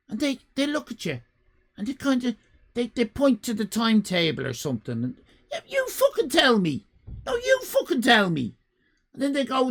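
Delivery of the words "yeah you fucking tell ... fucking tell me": much higher, exasperated retort; mock exasperation. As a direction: snap back at the station clerk. Acting own reply to clerk.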